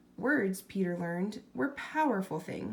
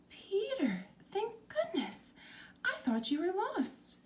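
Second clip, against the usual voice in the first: much higher, breathless relief, hushed on thank goodness; relieved mother; 11 semitones higher than usual. A narrator's reading. Mother finding her lost son, higher and relieved. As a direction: gasp with relief at finding him. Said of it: "Mother didn't approve".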